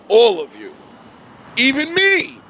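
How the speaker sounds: sudden high, loud punch on Even me; emphatic. Real, not acted. Preacher to his viewers, punching each word high and loud. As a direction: hit all of you, then Even me. Preacher stressing God hates everyone.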